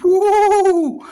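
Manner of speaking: very high, loud whoop of delight; whooping at the sizzling pork chops; excited whoop. Real, not acted. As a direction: let out a big joyful woo. Reacts to the cooked chops.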